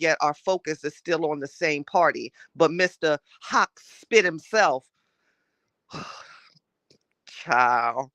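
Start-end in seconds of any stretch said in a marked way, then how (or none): 5.9-8.1 whispered sigh. Real, not acted. Fed up with the people, a whispered sighing child. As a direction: sigh it out under your breath. Exasperated aside.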